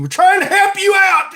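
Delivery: high, loud mock desperation; mock pleading shout. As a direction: holler it like an exasperated hawker. Mock pleading sales pitch.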